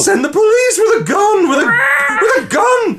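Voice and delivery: frantic high shrieking 911 caller; panicked caller. Acted. Frantic caller screaming at the dispatcher. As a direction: scream for help like it's life or death. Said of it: "you be dispatcher, and I'll be herald".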